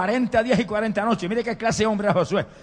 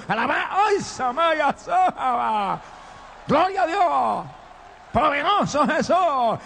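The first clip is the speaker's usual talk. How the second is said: high, drawn-out cries of worship; real revival-meeting outburst; ecstatic praise; 7 semitones higher, much slower than usual. Real, not acted. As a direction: cry out in fervent praise, stretching the words. Preacher bursts into ecstatic praise.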